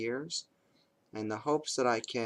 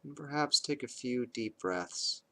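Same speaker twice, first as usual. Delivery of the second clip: calm, measured meditation voice with long pauses; guided meditation. Acted. Parent leading kids through bedtime meditation, calm and measured. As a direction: guide them unhurriedly into awareness of the breath, leaving space between phrases.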